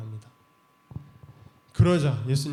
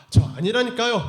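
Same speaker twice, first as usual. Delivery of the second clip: louder, higher insistent denial; 7 semitones higher, 8 dB louder than usual. Acted. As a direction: shout it's not me, cornered. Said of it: "하니까".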